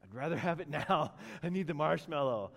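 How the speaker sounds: put-on impatient kid giving in. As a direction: cave in like a craving child. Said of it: "finally he's like".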